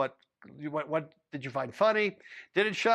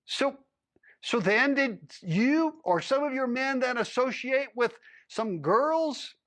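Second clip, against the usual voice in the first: a higher, pressing official's voice; lightly voiced higher lawman interrogating a cowboy; stern lawman; 7 semitones higher, slower than usual. A narrator's reading. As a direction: lean in like a lawman demanding answers.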